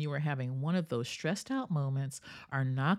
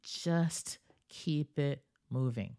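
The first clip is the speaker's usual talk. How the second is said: slow, savoring "Just keep it moving"; slow emphasis; slower than usual. Shown as a demonstration. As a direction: lean in and draw each word out. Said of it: "I love this".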